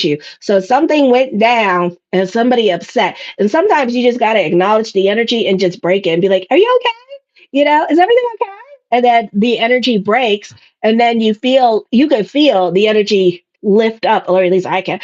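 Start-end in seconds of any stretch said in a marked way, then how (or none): 6.5-8.5 high, quick, sweet concern; a much higher, fast little "are you okay"; caring check-in. Retold or imitated. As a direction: chirp it up brightly to lift the mood. Breaking the room's energy.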